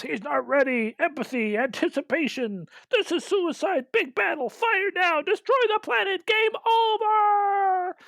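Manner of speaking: climbing high-pitched mock battle cries; mock yelling. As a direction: yell like a whole panicking crew, pitch building. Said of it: "everybody yells".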